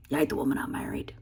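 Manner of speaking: hushed, fast unvoiced whisper of his words. Wife whispering the husband's line that stung her. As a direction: breathe the hurtful line out, fast and toneless.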